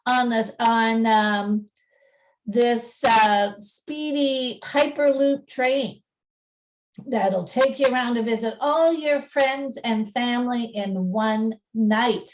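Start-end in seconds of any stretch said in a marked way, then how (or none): none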